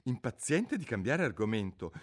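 ordinary delivery

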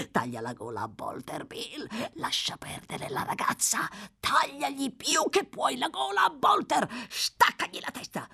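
high, rasped murderous ranting; delirious villain voice, leaping upward; crazed raving. Acted. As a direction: urge the killing in a mad frenzy.

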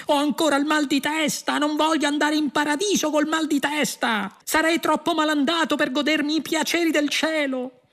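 very high, frantic whining yell; shouted complaint. A narrator's reading. Prisoner protesting his death with a headache, high wailing shout. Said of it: "urlò".

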